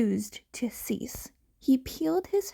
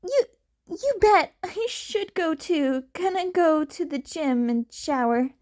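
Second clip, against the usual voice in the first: Izuku voice; 6 semitones higher than usual. Acted. Izuku on phone.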